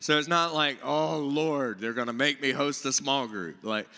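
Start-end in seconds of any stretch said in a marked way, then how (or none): none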